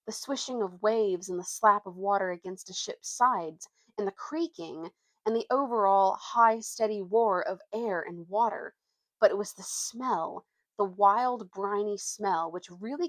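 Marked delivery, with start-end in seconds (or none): none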